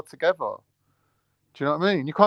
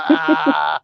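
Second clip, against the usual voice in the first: laughing realisation; 4 semitones higher, 4 dB louder than usual. Retold or imitated. Realising the setup.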